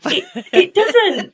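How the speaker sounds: laughing it off, high and slow; a real giggle of disbelief. Real, not acted. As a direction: protest through a laugh. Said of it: "laughing denial".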